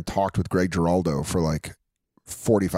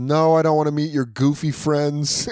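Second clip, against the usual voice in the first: higher, louder mock brush-off; imitating Patrice; 7 semitones higher, 4 dB louder than usual. Patrice snubbing Joe's friends, a higher, louder mock dismissal. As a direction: toss off a cheeky dismissal. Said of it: "he goes".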